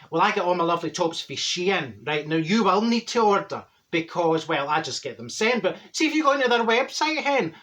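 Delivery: ordinary talk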